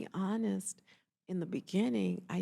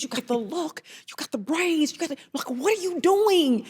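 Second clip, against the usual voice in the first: rising, insistent, higher and louder; emphatic colleague pushing; 8 semitones higher, 6 dB louder than usual. Retold or imitated. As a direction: push her friend like a big sister. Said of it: "she goes".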